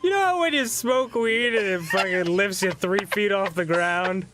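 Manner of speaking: mocking impression. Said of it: "You're like".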